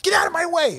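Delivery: high, urgent imitation of a rescuer; acted frantic urgency. Retelling Stevie pushing through the crowd.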